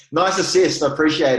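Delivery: laughing through the words. Real, not acted. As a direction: chuckle as you say it. Laughs at the perfect transition.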